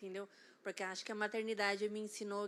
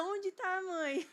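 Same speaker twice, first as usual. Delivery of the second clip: put-on child's question; 10 semitones higher, 3 dB louder, much slower than usual. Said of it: "Aí o meu filho".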